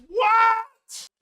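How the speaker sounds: very high, loud shriek of disbelief; incredulous shout. Real, not acted. Streamer reacting to a weight loss jab, incredulous shout. Outraged reaction to a chat comment.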